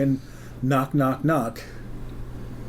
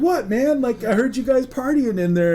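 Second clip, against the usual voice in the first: high-pitched clueless defence; innocent protest; 10 semitones higher than usual. Retold or imitated. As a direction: play the wronged, oblivious friend. Eric protests being scolded.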